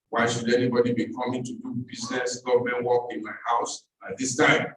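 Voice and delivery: ordinary delivery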